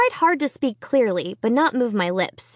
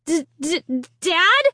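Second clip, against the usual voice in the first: halting, stammered start; stuttering; 7 semitones higher, slower than usual. A narrator's reading. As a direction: stammer out the first word. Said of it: "I stuttered".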